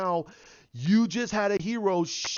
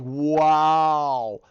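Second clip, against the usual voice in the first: a much louder drawn-out wow; stunned wow; 9 dB louder than usual. Real, not acted. Reacting to the universe being wiped out, stunned loud wow. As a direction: let the wow hang in real disbelief.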